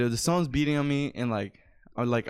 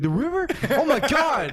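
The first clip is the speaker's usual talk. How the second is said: high, incredulous echo of the answer; pitched way up in amazement; amazed kid; 9 semitones higher, 4 dB louder than usual. Retold or imitated. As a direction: react like an astonished kid. Kid's amazed reply, acted.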